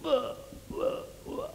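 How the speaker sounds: hollow, soft "wah" noises; breathy imitated "wah, wah, wah"; imitated child's noise. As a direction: mimic short wordless outbursts, softly. Imitates the child's sudden reaction.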